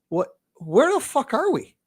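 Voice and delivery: high shocked disbelief; incredulous. Retold or imitated. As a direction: squeak it out in disbelief. Retold own disbelief.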